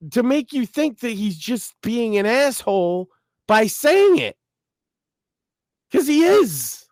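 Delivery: high-pitched, emphatic disbelief; exasperated rant. Real, not acted. Making Sam understand Dave, exasperated high-pitched rant.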